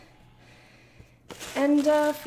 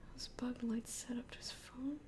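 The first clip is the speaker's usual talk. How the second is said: whisper; 3 semitones lower, 19 dB quieter than usual. Real, not acted. Whispering while reading chat.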